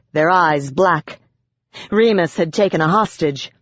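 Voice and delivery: ordinary delivery, nothing marked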